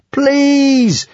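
high, loud, drawn-out desperate shriek. A narrator's reading. Three Vikings beg the dragon to whistle, shrieking. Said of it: "shrieked all three Vikings simultaneously".

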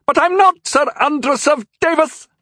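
put-on very high, loud old man's voice; panicked old knight, high and loud; insisting old knight. A narrator's reading. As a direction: protest it urgently. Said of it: "the old knight insisted".

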